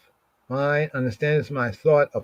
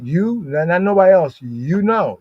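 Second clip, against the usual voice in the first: YOU hit high and hard; single out YOU above everyone else; emphatic YOU; 5 semitones higher, 4 dB louder than usual. Shown as a demonstration. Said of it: "when you emphasize like you".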